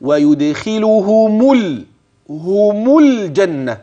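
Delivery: teacher modelling the connection; slow recitation demo. Shown as a demonstration. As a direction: stretch ويدخلهم الجنة out for the student. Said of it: "كيف؟".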